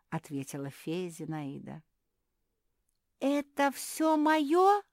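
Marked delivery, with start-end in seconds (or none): 3.2-4.8 high, loud, stretched-out disbelief; overjoyed. A narrator's reading. Zefirka seeing her box of sweets, overjoyed. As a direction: reel with joy, drawing the words out high. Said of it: "зашаталась от радости Зефирка".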